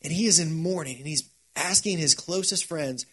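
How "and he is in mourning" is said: ordinary talk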